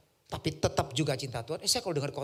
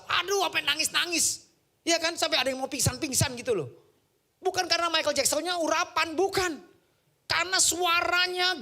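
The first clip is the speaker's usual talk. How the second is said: high, loud pulpit fervour; full-force sermon delivery; fervent preaching; 11 semitones higher, 6 dB louder than usual. Acted. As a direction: preach it with rising heat.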